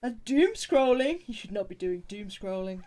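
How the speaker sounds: mock shock. Real, not acted. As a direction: act scandalized at the news. Mock-scandalized reaction to chat.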